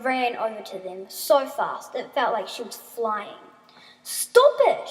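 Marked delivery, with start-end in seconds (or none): 4.0-4.9 high, forceful shout; sharp loud cry; extremely loud. A narrator's reading. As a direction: shout to halt an attacker. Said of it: "she said extremely loud".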